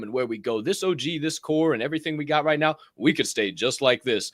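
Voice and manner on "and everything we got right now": usual speaking voice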